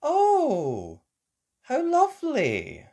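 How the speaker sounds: high, loud, drawn-out coo; delighted mother. Acted. Mummy Pig stepping out at the beach, delighted. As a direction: gush over the surprise.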